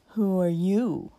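loud, drawn-out challenge; slurred, swaggering drunk; belligerent drunk. A narrator's reading. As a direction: demand it slowly, spoiling for a fight. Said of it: "asked the fellow with an oath".

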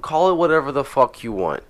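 normal talking voice

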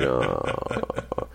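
normal talking voice

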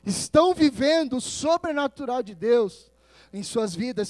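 ordinary delivery

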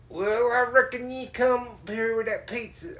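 Southern drawl. Retold or imitated. Bubba asking the pizza driver about the pizza, Southern drawl. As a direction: put on a thick rural Southern voice. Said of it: "say the next words normal".

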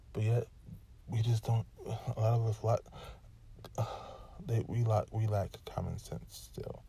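normal talking voice

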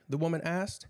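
usual speaking voice

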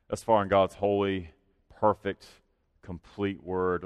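usual speaking voice

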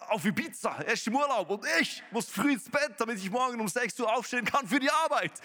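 high, loud indignant rant, rising to a yell; envious outburst. Acted. Scroller in bed seeing the neighbour on Ibiza, envious outburst.

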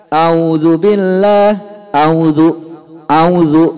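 a'udzu said loud as plain auzu without the ain; wrong auzu. Shown as a demonstration. Teacher loudly showing the wrong auzu. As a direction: hammer out auzu with no throat sound. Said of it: "jangan dibaca".

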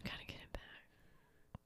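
slow breathy whisper echo. Real, not acted. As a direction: whisper it back slowly. Echoed in a whisper.

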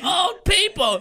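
high, loud, drawn-out wail; mock old people. Joking about the amused old couple, high loud mock wail. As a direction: stretch it into a hammed-up shriek.

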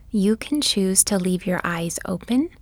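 normal talking voice

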